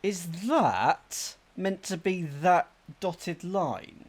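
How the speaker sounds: slow, drawn-out question; slow puzzling. Real, not acted. Player peers at a map clue, slowly puzzling. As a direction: ask it slowly, working it out.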